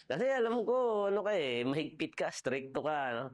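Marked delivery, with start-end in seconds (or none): none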